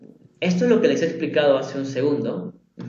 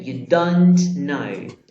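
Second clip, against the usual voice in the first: slow contraction; short form; 6 dB louder, much slower than usual. Shown as a demonstration. Teacher showing students the short form, slow. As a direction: stretch out the contraction for the class. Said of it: "Puede ser".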